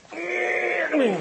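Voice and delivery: high, slow, wailing mock sob; mock crying. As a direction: stretch it out like a crying child. Mimicking daughter crying.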